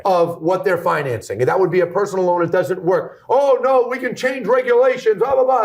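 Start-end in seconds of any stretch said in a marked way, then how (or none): 3.3-5.6 high-pitched dismissive mimicry of opponents; mocking imitation. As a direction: parrot their brush-off in a raised, scornful voice. Mocks what the other side said.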